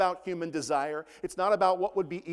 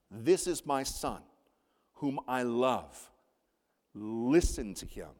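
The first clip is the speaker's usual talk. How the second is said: slow, solemn voice of God; voice from the cloud; 4 semitones lower, slower than usual. Retold or imitated. The Father speaks from the cloud about his son, slow and solemn. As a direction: speak with great deliberate weight, pausing between phrases. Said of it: "a voice came from the cloud".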